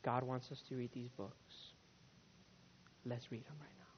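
very soft and low, with pauses; hushed quote. Retold or imitated. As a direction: voice the couple's quiet, awed agreement almost under the breath. Couple's hushed words to each other.

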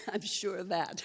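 said through a laugh; laughing quip. Real, not acted. Answering God's promise of a miracle, laughing. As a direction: toss it back with a laugh. Joking reply to God.

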